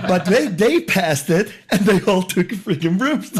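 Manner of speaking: laughing through the words; chuckling while telling it. Real, not acted.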